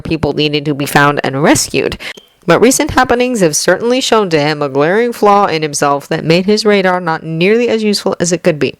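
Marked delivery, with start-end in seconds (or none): none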